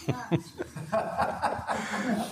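soft laugh. Real, not acted. Laughs at name Angel.

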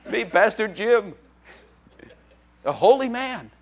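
mock-pompous, laughing through the words, slow; laughing mock pride. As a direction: puff yourself up as a holy man while cracking up. Joking self-importance, laughing.

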